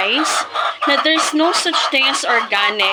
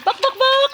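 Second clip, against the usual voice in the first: high chicken-call clucks; 12 semitones higher than usual. Real, not acted. Vlogger calling her chickens to feed with clucks.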